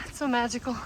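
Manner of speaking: panting on a steep uphill climb; breathless between words; heavy breathing. Real, not acted. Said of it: "Sorry about the heavy breathing".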